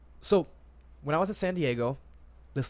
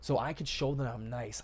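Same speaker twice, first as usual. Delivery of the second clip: ordinary talk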